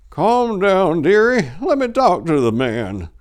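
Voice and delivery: calm his wife down, a touch cautioning; cautioning old man. A narrator's reading. Old husband soothing his wife, gently cautioning. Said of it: "cautioned Mister Pickles".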